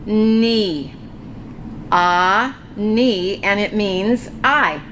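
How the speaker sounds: a and ni said apart and slowly; sounding out syllables. Shown as a demonstration. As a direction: break ani into its syllables. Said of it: "this is going to be".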